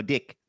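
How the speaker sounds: "Dick" for deck, rising tune; New Zealand vowel. Shown as a demonstration. Host teaching the accent, deck said "Dick" going up. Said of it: "you say dick instead of deck".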